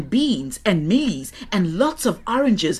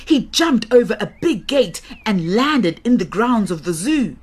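usual speaking voice

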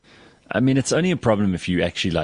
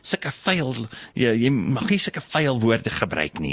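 disapproving tannie; 3 semitones higher, slower than usual. Retold or imitated. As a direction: imitate a prim South African auntie tutting at bad language. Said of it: "they're disapproving of me".

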